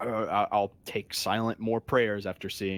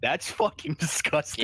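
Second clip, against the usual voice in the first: higher-pitched revolted outburst; real disgust; 6 semitones higher, 4 dB louder than usual. Real, not acted. Player reacting to the skin flakes joke, loudly disgusted. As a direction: recoil from it, pitch jumping up.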